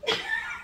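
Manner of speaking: high-pitched burst of laughter. Real, not acted. Vlogger amused by her own remark, laughing.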